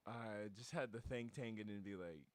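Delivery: usual speaking voice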